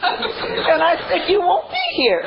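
high breathless laughter breaking the words; can barely speak for laughing; laughing hard. Real, not acted. Cracks up retelling punchline.

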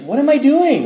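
high, loud mock-innocent protest; bewildered protest. Son answering his yelling mom, high baffled protest. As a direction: pitch it way up, playing the baffled kid.

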